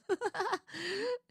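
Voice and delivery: a long high burst of laughter she can't control; helpless high laughing fit. Real, not acted. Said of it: "Your laughter is so wonderful".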